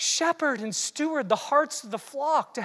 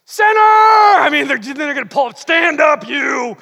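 mock prophet shout; 3 semitones higher, 11 dB louder than usual. Mocking an old-style prophet calling out sin, loud. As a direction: bellow at sinners like a bearded prophet. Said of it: "somebody with a long beard and a staff".

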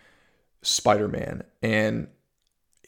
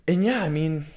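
ordinary talk, nothing marked

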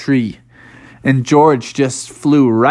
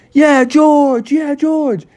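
cheering crowd; 10 semitones higher, much slower than usual. Acted. Everybody cheering his name.

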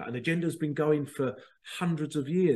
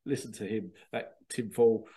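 ordinary delivery

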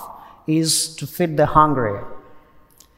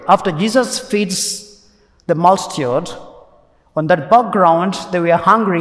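ordinary talk

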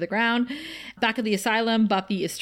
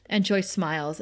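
usual speaking voice

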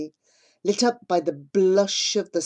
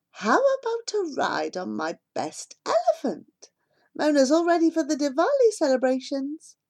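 higher, friendly villager voice; lightly voiced mahout; 8 semitones higher than usual. A narrator's reading. Mahout welcoming the family back, light high voice. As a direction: greet returning neighbours warmly.

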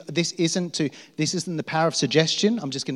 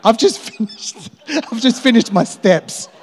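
high and loud, laughing through it, slower; sheepish laughing brag; chuckling embarrassed boast; 7 semitones higher, 5 dB louder than usual. Retold or imitated. Retelling his line to Eddie.

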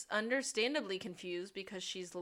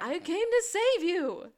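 mock hero; 9 semitones higher, 10 dB louder than usual. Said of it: "acted exchange".

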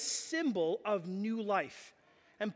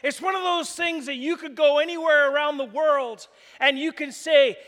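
normal talking voice

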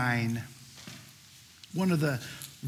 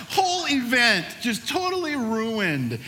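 ordinary delivery

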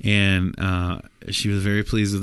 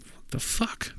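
shocked hiss; 9 dB quieter, slower than usual. Acted. John hears the price.

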